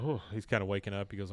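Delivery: ordinary delivery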